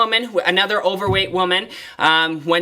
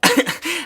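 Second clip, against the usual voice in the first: sudden high laugh; burst of laughter; 10 semitones higher than usual. Real, not acted. Trainer laughing at the client's patio story, bursting laugh. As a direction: break out laughing. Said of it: "I just kind of broke out laughing".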